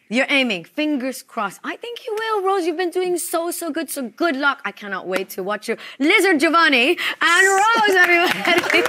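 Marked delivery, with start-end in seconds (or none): 6.0-8.8 announcer call-out. Real, not acted. Host announcing couple to audience.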